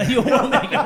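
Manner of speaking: high, loud laughing echo; mock echo.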